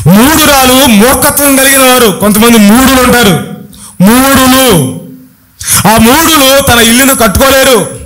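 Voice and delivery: driving, high-pitched sermon delivery; rhythmic, insistent pulpit declamation; full-force preaching. Real, not acted. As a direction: preach relentlessly, hammering each repeated phrase.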